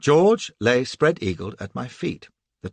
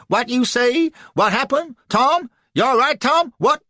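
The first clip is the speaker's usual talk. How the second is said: much higher, louder worried shouts; yelling to Tom as he runs, much higher; alarmed calling; 13 semitones higher, 8 dB louder than usual. Acted. George runs up, calls to Tom.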